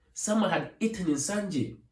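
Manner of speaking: plain reading voice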